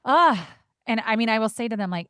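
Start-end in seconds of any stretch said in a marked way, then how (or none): 0.1-0.4 loud, high fed-up groan; mock exasperated groan; exasperated ugh. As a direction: let out a frustrated ugh. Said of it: "And I'm like".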